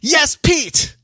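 high, put-on yes sir voice; playful put-on voice; mock recruit reply. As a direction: answer like a nervous recruit. Mock recruit-style answer.

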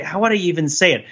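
ordinary talk, nothing marked

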